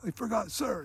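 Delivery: high, softened put-on reply; imitating cowed recruit. Recruit owns up to the sergeant, mock meek reply. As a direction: answer meekly, as a mock recruit.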